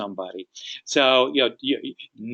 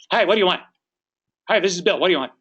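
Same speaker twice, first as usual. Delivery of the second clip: high, curt phone pickup; brusque New Yorker; 5 semitones higher, much faster than usual. Acted. New York homeowner answering the phone, brusque. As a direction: answer the phone abrupt and impatient. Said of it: "the person from New York".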